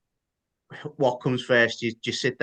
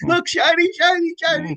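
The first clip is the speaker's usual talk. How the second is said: silly high voice; 5 dB louder than usual. Pretend. Joking about the shiny logo.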